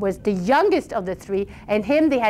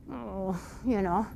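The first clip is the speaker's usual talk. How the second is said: ordinary delivery, nothing marked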